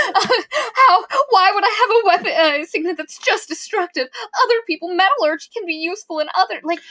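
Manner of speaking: high, loud mock wail breaking into laughing sobs; mocking whiny heroine. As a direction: parody a melodramatic heroine, half laughing, half fake crying. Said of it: "I'm not okay with her being like".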